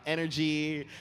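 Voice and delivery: usual speaking voice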